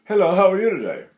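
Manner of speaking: quick, light greeting; speech placed low. Shown as a demonstration. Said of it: "like this".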